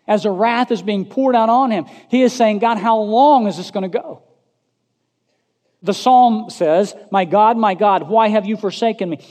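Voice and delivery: high, loud pulpit voice; forceful preaching. Real, not acted.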